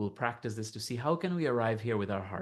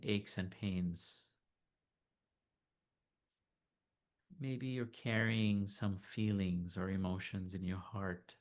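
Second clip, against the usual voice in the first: soft, unhurried meditation voice; guided meditation; 6 dB quieter than usual. Acted. Teacher leading listeners to notice body and heart, calm meditation voice. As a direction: gently guide them inward, leaving long silences.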